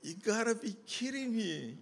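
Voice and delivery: high incredulous; half-laughing disbelief. Retold or imitated. Reacting to the director's plan, high disbelief. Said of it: "And I went".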